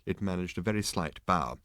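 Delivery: ordinary delivery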